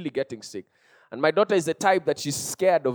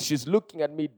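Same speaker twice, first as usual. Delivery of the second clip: usual speaking voice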